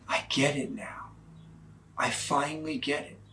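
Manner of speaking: soft, hushed realization; hushed revelation. Retold or imitated. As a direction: say it softly, like a secret dawning. Husband's quiet revelation at church.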